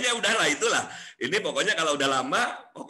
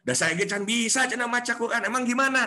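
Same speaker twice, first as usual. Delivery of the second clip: high, loud eager question; eager Sundanese reply; 8 semitones higher, 4 dB louder than usual. Retold or imitated. Friend realizing they need it, eager and loud. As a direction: lean in, suddenly curious.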